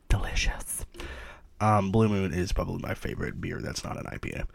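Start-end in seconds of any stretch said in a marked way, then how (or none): 0.1-0.9 whispered. Real, not acted. Savoring the beer.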